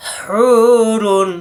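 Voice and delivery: হুরুন with হু pressed and held long; stressed, stretched vowel. Shown as a demonstration. Teacher pressing the হু of হুরুন for students. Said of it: "হুকে চাপ দিয়ে এক আলিফ টানতে হবে".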